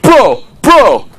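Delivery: high, hammed-up bro shout; mock bro call. Mocking the club bros, a high hammed-up bro call. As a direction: ham up a dudebro calling his buddy.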